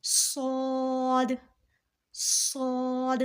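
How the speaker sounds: صاد as heavy whistled s with rounded long "aw"; thick, hard s. Shown as a demonstration. Teacher modelling for learners, صاد thick and drawn out. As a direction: round and stretch صاد slowly. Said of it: "موٹا بھی پڑھیں گے".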